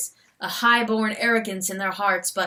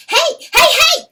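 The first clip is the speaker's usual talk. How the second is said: high loud excited calls; hollering with glee, much higher; shouted; 11 dB louder than usual. Acted. Weasel hailing the stag, high excited shouts. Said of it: "the weasel shouted".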